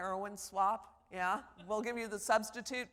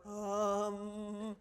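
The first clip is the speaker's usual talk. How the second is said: long, level hummed um; class-wide drawn-out um. Retold or imitated. Professor mimicking her class, drawn-out filler um. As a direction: hold one flat-pitched um like a whole class. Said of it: "the whole class comes in with".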